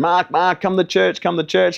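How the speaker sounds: high-pitched imitation of an eager invitation; mimicking an excited girlfriend; eager calling out. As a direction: urge a friend along, pitched well up. Girlfriend calling him to church.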